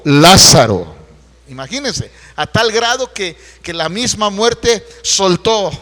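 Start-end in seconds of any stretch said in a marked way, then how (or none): 0.0-1.0 loud commanding call; voice of authority. Retold or imitated. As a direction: call Lázaro out with full authority. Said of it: "con una voz muy, con autoridad". (llamado fuerte y con autoridad)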